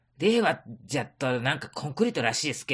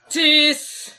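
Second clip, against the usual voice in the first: loud greeting; 10 semitones higher, 8 dB louder, slower than usual. Acted. Greeting arrival loudly.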